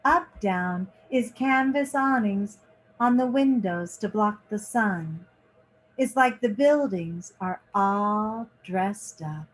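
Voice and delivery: lively picture-book reading, lingering on each page; reading to children. A narrator's reading. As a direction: read it like story time for kids. Reads picture book aloud.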